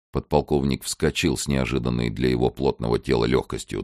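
ordinary delivery

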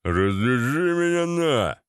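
slow, drawn-out lout's holler; mock yell in a put-on voice; bellowing thug. A narrator's reading. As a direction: stretch the vowels like a bawling thug. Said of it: "раздался ор Вована".

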